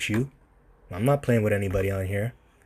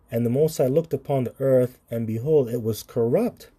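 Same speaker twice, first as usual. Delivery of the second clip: scripture reading; 3 semitones higher than usual. Acted. Reads scripture aloud.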